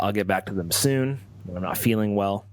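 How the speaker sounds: ordinary talk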